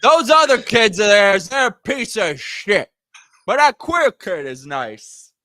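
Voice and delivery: hammed-up blunt old grandpa; grandfather voice. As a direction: bellow it like a blunt old man. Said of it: "He's like".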